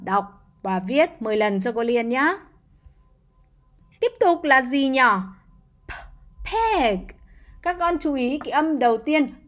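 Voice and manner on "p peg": PEG with a burst of air on the p; crisp, puffed p starting "peg"; popped initial p. Shown as a demonstration. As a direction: pop the P of "peg" out first. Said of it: "chúng ta sẽ bật ra cái từ p".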